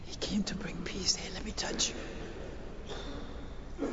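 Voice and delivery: whispered, reverent preaching; hushed tenderness. Real, not acted. Preacher telling of Jesus bringing peace, whispered. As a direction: offer it very softly.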